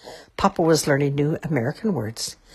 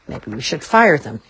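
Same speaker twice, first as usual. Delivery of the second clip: usual speaking voice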